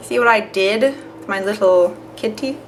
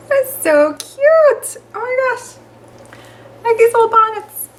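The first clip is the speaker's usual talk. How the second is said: very high, drawn-out squeal of adoration; cooing over cuteness; 4 dB louder, slower than usual. Real, not acted. Cooing over her baby clothes.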